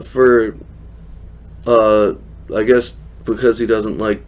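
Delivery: ordinary delivery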